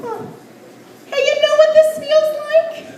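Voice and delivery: high, laughing creepy come-on; creepy cat petter. Retold or imitated. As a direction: lure them in with a leering purr. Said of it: "petting their cat and going".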